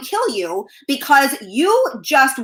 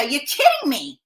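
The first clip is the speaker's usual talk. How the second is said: usual speaking voice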